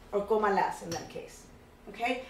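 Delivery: ordinary talk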